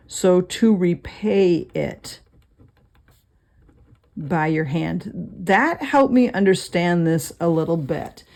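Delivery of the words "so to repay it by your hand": slow, measured restatement; slow repeat. Shown as a demonstration. Teacher repeating the meaning slowly for students, measured. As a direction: let each word land. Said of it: "repeating the meaning slowly".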